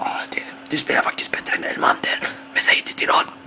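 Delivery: hush, just between us; secretive whisper. Retold or imitated. Teammates whispering a secret compliment to the player.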